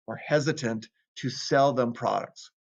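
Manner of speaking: normal talking voice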